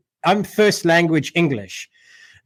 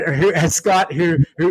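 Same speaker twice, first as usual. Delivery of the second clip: normal talking voice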